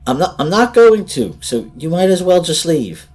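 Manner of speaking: high loud trembling refusal; shaky voice. Acted. Sandra refusing the children, frightened and shaking. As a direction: refuse while your voice trembles.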